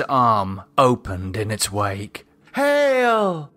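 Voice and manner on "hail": high, ringing shout of hail; exultant cry. Acted. The cult cheering the sacrifice, high exultant hail. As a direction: cry it out in rapture.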